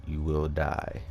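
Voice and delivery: ordinary talk, nothing marked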